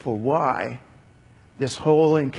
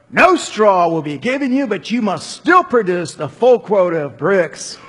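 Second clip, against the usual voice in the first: louder, raised furious order; shouting; 6 semitones higher, 8 dB louder than usual. Acted. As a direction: keep yelling the demand. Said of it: "Pharaoh shouted".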